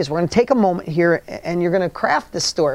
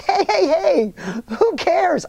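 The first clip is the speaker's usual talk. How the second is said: high-pitched, insistent reassurance; retold urgent reassurance; 11 semitones higher, much slower than usual. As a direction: cut in to stop someone's panic, pitched up. Calming the shaken driver, retold.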